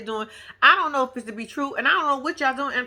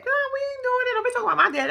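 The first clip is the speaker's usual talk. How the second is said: much higher, sassy daughter protecting her daddy; defensive daughter; 13 semitones higher, faster than usual. Pretend. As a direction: play the girl who won't let anyone touch her dad.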